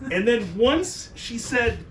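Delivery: normal talking voice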